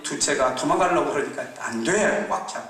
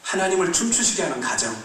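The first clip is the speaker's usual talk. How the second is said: measured, emphatic exhortation; preaching; 3 semitones higher than usual. Acted. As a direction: proclaim each line as a call. Sermon exhortation.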